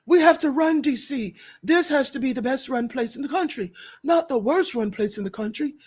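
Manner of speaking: light Trump voice, barely shifted; a touch of Trump's cadence; mock Trump. Vlogger quoting Trump on DC, understated mock. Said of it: "Trump said".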